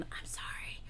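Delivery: soft whispered sorry; real hushed apology. Real, not acted. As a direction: drop to a whisper after yelling. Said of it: "Oh, I am yelling".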